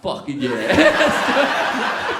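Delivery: loud, hugely stretched yeah; triumphant. Retold or imitated. Supermarket visionary answers doubting boardroom, triumphant. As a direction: belt out a drawn-out, gleeful yes.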